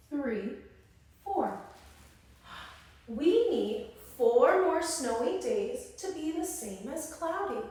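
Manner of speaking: normal talking voice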